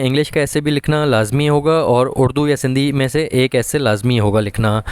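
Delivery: usual speaking voice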